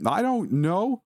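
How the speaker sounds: slow, very high distressed outburst; angry fear. A narrator's reading. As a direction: draw it out, frightened and frustrated. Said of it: "anger and fear now very present in his voice".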